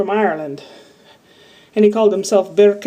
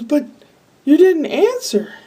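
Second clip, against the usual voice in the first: lady's reproach; 7 semitones higher than usual. Retold or imitated. Lady reproaches her, quoted.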